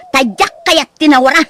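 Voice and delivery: ordinary talk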